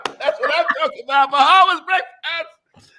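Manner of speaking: high, loud, thrilled call-out; host hyping Bahamian breakfast to co-host, excited cheer. Real, not acted.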